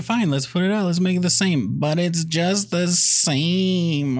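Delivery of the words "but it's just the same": high, slow drawn-out whine; whiny mock complaint. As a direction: drag it out like a sulking kid. Mocking whiny remake critics.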